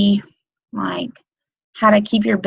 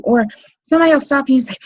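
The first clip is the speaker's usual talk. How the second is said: ordinary talk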